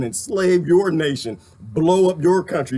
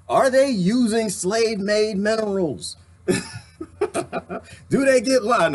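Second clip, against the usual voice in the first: ordinary talk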